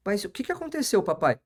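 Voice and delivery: lightly voiced small girl asking, high; a hint of a worried child asking her dad; little daughter. Imitating his daughter Sofia asking. (filha pequena perguntando ao pai, voz aguda)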